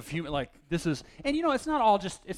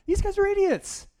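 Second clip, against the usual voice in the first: much higher, louder exasperated cry; exasperated outburst; 8 dB louder than usual. Retold or imitated. Young Bible reader blurting his verdict on the grumbling Israelites, higher and louder. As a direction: blurt it out in disbelief. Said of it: "that was my thought".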